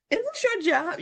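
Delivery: high-pitched incredulous question; mock amazement. Imitating amazed airport staff questioning her.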